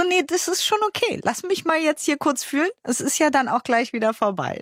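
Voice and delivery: high-pitched, light reassurance; retold soothing reply. Mother to her child who says don't get upset, retold high-pitched reassurance.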